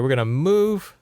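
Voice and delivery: ordinary talk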